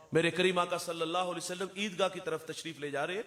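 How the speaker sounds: ordinary talk, nothing marked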